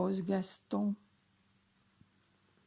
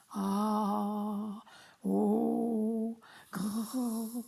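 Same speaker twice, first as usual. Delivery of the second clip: a lightly growled voice warm-up; warming up a big voice; 2 semitones higher, much slower than usual. Acted. Dad warms up his voice before the story, mock growl. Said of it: "je vais échauffer ma voix".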